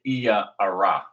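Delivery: Iya Ara split as "EE-ya a-RA"; original pronunciation. Shown as a demonstration. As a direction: say Iya Ara as two separate names. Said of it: "but it's really".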